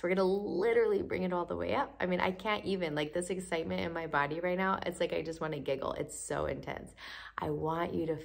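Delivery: usual speaking voice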